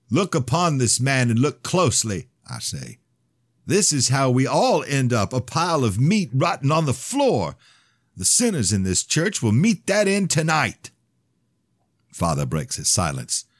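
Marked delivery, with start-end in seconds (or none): 0.1-2.3 high, loud fervent preaching. Acted. Preaching over the corpse. 3.6-10.9 high, loud fervent preaching. Acted. Preaching over the corpse.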